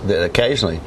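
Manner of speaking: ordinary talk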